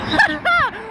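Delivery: high burst of laughter; laughing. Real, not acted. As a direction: laugh in disbelief. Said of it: "Why am I laughing at that?".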